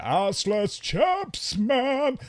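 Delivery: high, drawn-out goofy voice; mock caricature of his own voice; silly put-on voice. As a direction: stretch it out in a daft high voice. Mocking own recorded voice.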